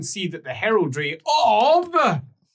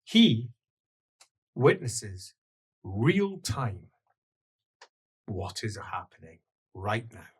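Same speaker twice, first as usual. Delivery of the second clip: game master scene setting; 8 semitones lower, 8 dB quieter than usual. Acted. Game master describes Leopold's vision of the Emperor, solemn narration. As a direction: unfold the vision phrase by phrase, low and measured.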